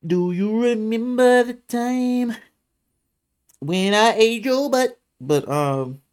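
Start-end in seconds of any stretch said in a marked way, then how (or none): 0.1-5.0 slow put-on voice.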